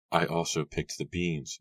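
usual speaking voice

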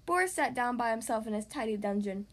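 plain reading voice

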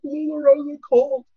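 imitated tearful wail. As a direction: blurt it out as if in tears.

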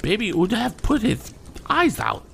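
put-on high little girl's voice with a lisp. A narrator's reading. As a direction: explain timidly, lisping like a child. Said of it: "lisped the little girl".

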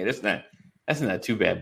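ordinary talk

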